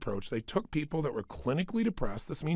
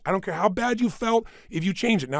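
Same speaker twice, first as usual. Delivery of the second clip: ordinary talk, nothing marked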